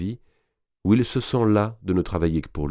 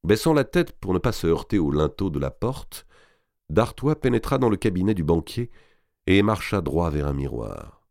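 plain reading voice

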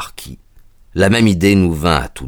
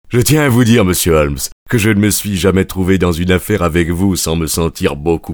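chaleureux; 3 dB louder than usual. A narrator's reading. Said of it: "avec chaleur".

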